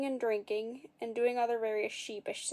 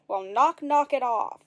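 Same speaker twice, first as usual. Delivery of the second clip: loud, cross rebuke; scolding; 7 dB louder, slower than usual. A narrator's reading. Angry woman scolding the prankster, loud rebuke. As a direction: tell him off sharply.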